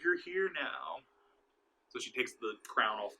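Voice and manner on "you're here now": eerie welcome. Retold or imitated. Cult woman welcoming the demon.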